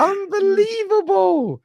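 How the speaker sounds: high, slow drawn-out disbelief; incredulous. Real, not acted. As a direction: stretch it out in shock. Repeats in disbelief.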